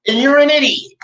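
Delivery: high, loud, put-on jeering "you're an idiot"; exaggerated mock scorn; mocking insult. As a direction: sneer it at the fans like a catchphrase. Catchphrase insult at absent fans.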